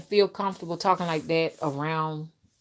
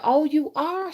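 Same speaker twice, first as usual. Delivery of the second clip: higher, lifted oh you are; surprised; 9 semitones higher, slower than usual. Retold or imitated. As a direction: act mildly taken aback. Surprised reply in retold chat.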